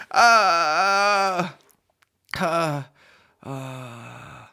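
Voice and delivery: long loud high wail fading away; drawn-out imitated moan, dying off; mock reaction groan. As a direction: let a fake crowd groan deflate. Mimics everyone's reaction to reveal.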